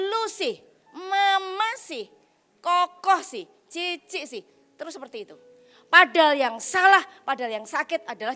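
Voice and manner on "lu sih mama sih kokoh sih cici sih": put-on sulky, slow finger-pointing child voice; whiny blaming. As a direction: blame everyone in a sulky whine. Acted blaming of family members.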